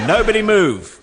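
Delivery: burglar order. Acted. Burglar voice in comic story.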